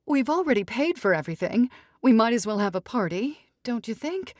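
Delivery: haughty mother fussing over daughter; dramatic society mother. Acted. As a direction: sweep in like a grand matriarch. Mrs Evers voice.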